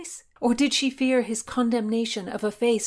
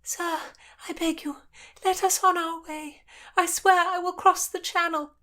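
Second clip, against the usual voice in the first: put-on high-pitched girl pleading her exile; young noblewoman announcing her flight; young woman's voice; 11 semitones higher than usual. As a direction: voice her higher, earnest and urgent.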